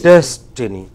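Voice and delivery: "Destiny" with a loud, punched first syllable; stress on DES. Shown as a demonstration. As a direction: punch "Des", then fall away on the rest of "Destiny". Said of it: "How do you have to pronounce it?".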